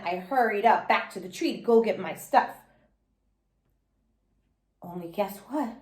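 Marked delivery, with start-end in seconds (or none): none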